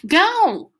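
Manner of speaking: high, loud shout; yelling. A narrator's reading. Freak yells at Max to run, high and loud. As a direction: yell it so he runs. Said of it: "Freak is yelling".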